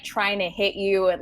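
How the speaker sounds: ordinary talk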